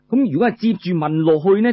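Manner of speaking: ordinary delivery, nothing marked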